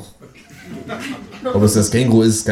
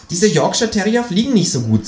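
high kangaroo voice; high, critical kangaroo; displeased kangaroo; 11 semitones higher than usual. Acted. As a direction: judge the dog's flight. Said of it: "sagt das Känguru".

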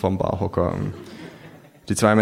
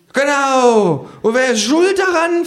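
high, loud angry question; cursing kangaroo; 5 dB louder, slower than usual. Acted. Kangaroo ranting about who is to blame. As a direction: spit it out in a rant. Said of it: "flucht das Künguru".

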